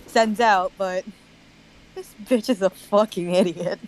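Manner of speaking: ordinary talk, nothing marked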